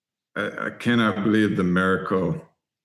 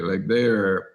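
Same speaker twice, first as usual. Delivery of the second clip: normal talking voice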